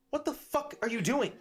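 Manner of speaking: angry barmaid. Acted. Barmaid Mywin confronting the troublemakers, angry. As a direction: storm over and confront them.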